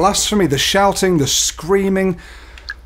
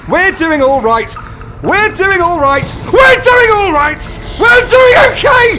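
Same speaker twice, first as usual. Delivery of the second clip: rising from a loud, high cry to a frantic scream; building panic, ending in a shriek; panicked shout building; 5 dB louder than usual. Real, not acted. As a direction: shout it louder and higher each time, losing it.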